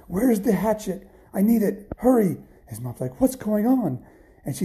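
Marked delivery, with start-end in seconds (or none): 0.1-2.4 high, loud, panicked demand; urgent calling. A narrator's reading. Boy chased by a giant to his mom, urgent shout. Fleeing Jack shouts for hatchet. 3.2-4.0 high-pitched, bewildered demand; alarmed question. A narrator's reading. Mom to her son running home in panic, alarmed.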